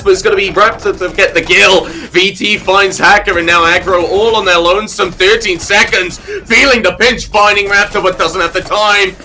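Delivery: caster builds the tension of a clutch finish; rising play-by-play excitement. Real, not acted.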